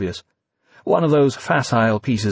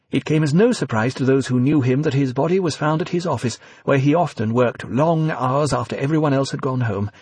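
news read; 4 semitones higher than usual. A narrator's reading. Newsreader eulogy on TV.

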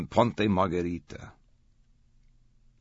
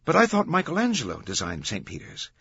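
higher-pitched puzzled objection; confused; 9 semitones higher, 3 dB louder than usual. A narrator's reading. Vittoria doubts who designed Saint Peter's, confused. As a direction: query it in a raised voice. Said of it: "Vittoria looked confused".

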